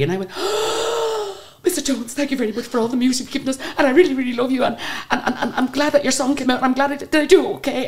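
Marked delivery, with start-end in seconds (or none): 0.3-1.4 high, drawn-out gasp; starstruck gasp. Acted. Fan greeted by her idol, high theatrical gasp. 1.6-7.9 high-pitched, breathless fan babble; starstruck gushing. Acted. Fan thanking [name] face to face, high excited babble. Gushing to her idol in story.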